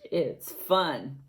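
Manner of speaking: ordinary delivery, nothing marked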